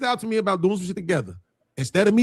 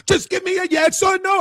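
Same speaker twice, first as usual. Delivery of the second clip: mock shout; 14 semitones higher than usual. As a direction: shout it like the impatient host, accent dropped. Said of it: "I can't do the Portuguese voice".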